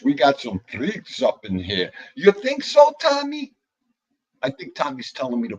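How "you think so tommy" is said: mock high voice. Teasing a viewer.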